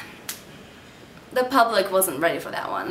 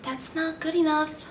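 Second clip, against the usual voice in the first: high, soft inner critic; soft, high and clear, over someone's shoulder; thin, clear whispering voice; 7 semitones higher, 5 dB quieter than usual. Acted. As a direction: say it thin and high, like a violin string. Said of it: "whisper in a thin, clear voice".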